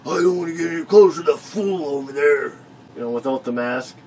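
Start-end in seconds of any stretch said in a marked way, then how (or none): 0.1-2.4 put-on louder old man grumble; grumpy old man. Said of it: "He's like".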